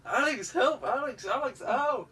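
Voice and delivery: high, loud hammed-up calls for help; mock cries for help. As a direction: yelp for Alex in mock panic. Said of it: "And he was like".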